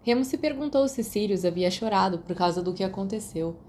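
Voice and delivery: usual speaking voice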